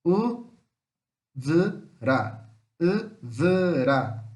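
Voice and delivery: U, V, RA each said apart; slow teacher's breakdown of U, v, ra; syllable by syllable. Shown as a demonstration. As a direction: sound out U, v, ra with a clear gap after each syllable. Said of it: "Três sílabas".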